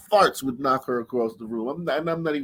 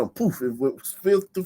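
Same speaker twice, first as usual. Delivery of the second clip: normal talking voice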